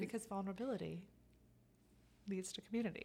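soft ASMR voice; hushed, intimate ASMR voice. Acted. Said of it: "you pulled out your S M R voice too".

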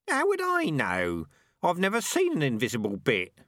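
high-pitched indignant rat; indignant protest. Acted. Rat who has never seen an invisible bit, indignant.

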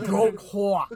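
put-on drawn-out New York accent. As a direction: savor each word in New York. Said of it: "with my New York accent".

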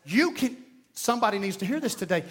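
ordinary talk

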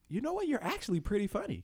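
high-pitched, patronizing mock surprise; condescending high-voiced praise; backhanded compliment. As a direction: imitate someone surprised you're any good. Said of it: "and it's that tone".

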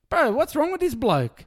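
high, slow, disbelieving bro; exasperated outburst. Real, not acted. Ranting to his co-host about the journalist, exasperated and high. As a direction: throw up your hands at this bloke.

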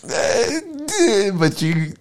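very high, whiny hedging; put-on high wheedling voice; hesitant Satan. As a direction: squeak out a reluctant objection. Satan hedges before objecting.

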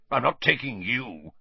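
lightly voiced angry uncle; snarled. A narrator's reading. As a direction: spit it at the boy, a bit snarly. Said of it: "he snarled".

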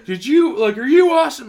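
high, loud demanding question; a hint of an irate owner; angry owner. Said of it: "He's like".

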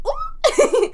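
very high, loud laughing squeak; squeaky ooh. Retold or imitated. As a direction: let out a big squeaky ooh. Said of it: "I just love the sound you made".

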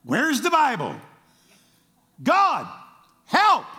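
high, loud, rising plea; panicked cry for help. Retold or imitated. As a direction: call out to God in sudden panic. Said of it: "And then we're like".

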